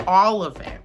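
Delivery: ordinary talk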